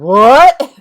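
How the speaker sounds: loud, high, rising mock shock; exaggerated imitation of a baffled outburst; Phil. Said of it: "And you're like".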